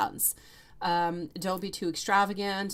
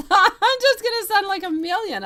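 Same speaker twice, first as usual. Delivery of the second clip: high, loud giddy burst; giddy squeal; 13 semitones higher, 9 dB louder than usual. Real, not acted. Reader about to spam hearts at chat, giddy squeal. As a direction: gleefully overdo it.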